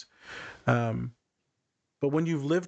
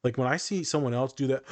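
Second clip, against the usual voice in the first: ordinary delivery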